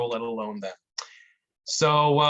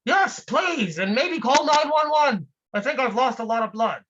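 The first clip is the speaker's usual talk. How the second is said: much higher and louder, frantic cries for help; panicked trapped man; 9 semitones higher, 3 dB louder than usual. Acted. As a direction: yell up from under the rock, desperate.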